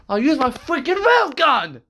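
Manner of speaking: shouting. Acted. Uzi shouting a threat at the villains. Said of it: "shouted Uzi".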